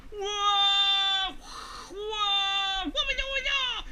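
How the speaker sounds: loud, high, drawn-out cries; acted alarm. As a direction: yell as if plunging through the air. Bear screams as bird dives.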